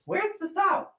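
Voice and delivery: falsetto question, spoken through; high searching call. Acted. Host hunting for the salt, high spoken falsetto. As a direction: ask in a high put-on voice.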